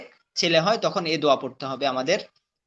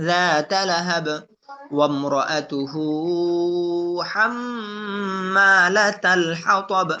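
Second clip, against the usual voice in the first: slow, measured tajweed chant; Quran recitation; much slower than usual. Shown as a demonstration. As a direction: recite with drawn-out vowels. Recitation demo.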